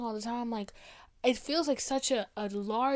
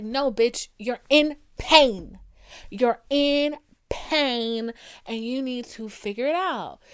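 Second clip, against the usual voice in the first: much louder, slow scolding; emphatic call-out; telling off; 7 dB louder, slower than usual. Acted. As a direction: lay down the truth to them, slowly. Telling off an ego-driven person.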